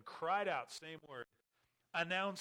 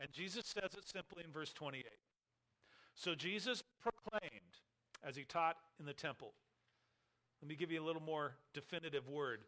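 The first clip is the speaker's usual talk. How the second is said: ordinary talk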